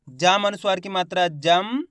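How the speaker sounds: slow spelling, "जम" rising and closed on m; half m spelling demonstration. Shown as a demonstration. Teacher asking students to listen carefully, slow spelling demonstration. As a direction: spell it out slowly so the m of "जम" is heard. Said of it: "गौर से सुनना है आपको".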